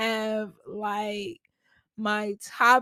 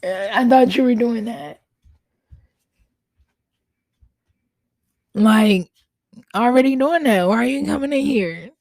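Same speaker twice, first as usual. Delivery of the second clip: exasperated mother; 8 dB louder, faster than usual. Real, not acted. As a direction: raise your voice at your son. Mother scolds son interrupting her recording.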